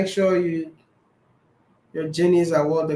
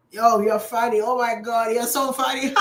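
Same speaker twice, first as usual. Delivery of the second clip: excited fan; 5 semitones higher than usual. Retold or imitated. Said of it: "they'll tell you that you are so funny".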